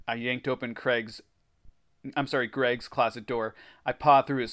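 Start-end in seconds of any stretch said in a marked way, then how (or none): none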